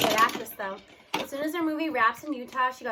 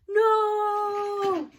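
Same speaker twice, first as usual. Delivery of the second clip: loud, high wail of grief; a boy's heartbroken howl; anguished cry; 9 semitones higher, 8 dB louder than usual. Acted. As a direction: cry out in pure agony. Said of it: "The cry from Aldo was pure agony".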